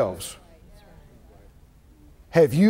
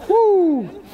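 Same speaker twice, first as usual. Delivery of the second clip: whoo; 7 semitones higher than usual. Real, not acted. Preacher reacting to God's challenge.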